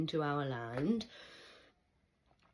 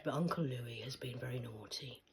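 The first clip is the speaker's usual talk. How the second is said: softer, lower conspiratorial whisper; 5 semitones lower, 7 dB quieter than usual. Real, not acted. Telling viewers about naughty Uncle Louis, whispering. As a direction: whisper it like gossip.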